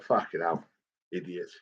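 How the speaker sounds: fading to almost nothing; muttered aside. Retold or imitated. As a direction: mutter it as the door closes. Son mutters after fake eviction.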